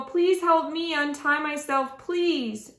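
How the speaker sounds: mildly acted begging for help; pleading cry. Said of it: "Cried Cardwell".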